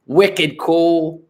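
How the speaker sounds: high mock Boston accent. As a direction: put on thick Boston slang. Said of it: "they have this really thick accent".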